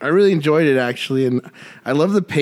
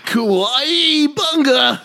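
overacted anime dub; 7 semitones higher, much slower than usual. Ninja Turtle mashup.

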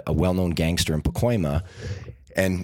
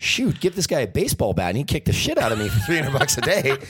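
laughing disbelief; 7 semitones higher than usual. Retold or imitated. Danny laughing at pay.

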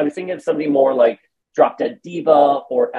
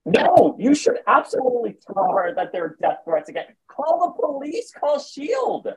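high exasperated voice; 12 semitones higher, 4 dB quieter than usual. Retold or imitated. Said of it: "I'm like".

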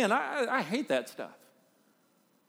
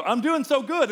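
much higher and louder, preening; smug self-praise; 10 semitones higher, 7 dB louder than usual. Retold or imitated. Preacher voicing a self-satisfied believer, preening sing-song. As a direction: crow it like you've got it all together. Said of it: "and you may go".

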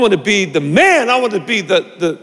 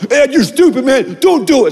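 put-on kid brother; 8 semitones higher than usual. Younger brother warning Ed not to do it, lightly voiced and higher. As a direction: voice the little brother warning him, a bit higher. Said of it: "It's my brother".

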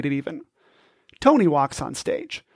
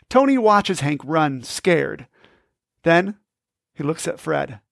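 ordinary delivery

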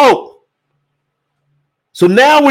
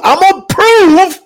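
"I'm gonna prove" cried out high and loud; climactic cry; 12 semitones higher, 5 dB louder than usual. Acted. Preacher building to a climax, "I'm gonna prove" cried out high. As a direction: lift "prove" into a shout. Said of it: "repeated build-up".

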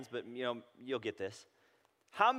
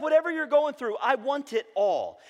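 God welcoming; 11 semitones higher, 8 dB louder than usual. Retold or imitated. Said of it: "God's like".

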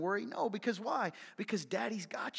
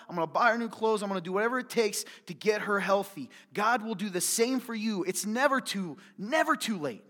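louder, higher, passionate preaching; preaching at full force; 5 semitones higher, 7 dB louder than usual. Real, not acted. Preacher pleading with the congregation about a father's love, full-force preaching. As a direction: drive it home like a fired-up preacher.

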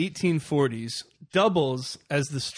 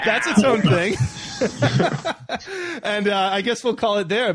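laughing; 7 semitones higher, 4 dB louder than usual. Real, not acted. Host laughing at his own joke.

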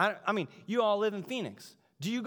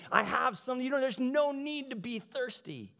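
high-pitched, playful pitch to the listener; jokey Jesus voice lifted high; silly Jesus; 6 semitones higher than usual. Acted. Preacher plays Jesus flipping the idea, silly high voice. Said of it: "if he was silly".

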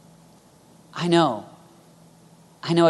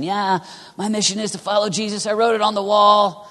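higher, put-on recital of a motto; mildly mocking, raised pitch; mock self-satisfaction; 6 semitones higher, 3 dB louder than usual. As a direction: lightly imitate someone pleased with himself. Mimics youth worker reciting mission.

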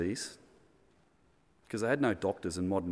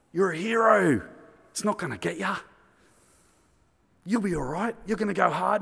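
know-it-all pub mate; 7 semitones higher, 4 dB louder than usual. Retold or imitated. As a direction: play the cocky old drinker reassuring his mate. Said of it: "And the other guy said".

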